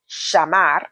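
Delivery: chamar with the ll said as sh; SH sound for double L. Shown as a demonstration. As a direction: say the ll in chamar like English sh. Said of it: "There's the S H pronunciation".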